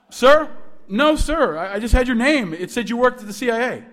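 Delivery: put-on high, flustered young caller; startled, deferential student. Student excusing himself to the CIA director, flustered. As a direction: stammer it out high and apologetic.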